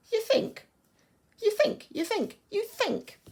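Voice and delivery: high, loud, repeated "think"; emphatic repetition. Shown as a demonstration. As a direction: repeat "think" with growing disbelief, pitched high. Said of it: "read that word with real emphasis".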